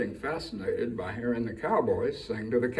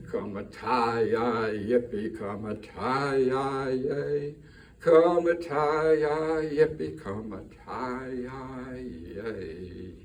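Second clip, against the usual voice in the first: slow fading chorus; 2 semitones higher, much slower than usual. Shown as a demonstration. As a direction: let the yippee-yay die away slowly. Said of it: "the pace of the song would slow down".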